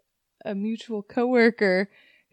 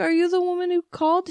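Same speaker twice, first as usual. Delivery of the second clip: high quick customer on the phone; mock customer voice; 9 semitones higher, faster than usual. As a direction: ask it fast and high like a caller. Customer voiced in retold call.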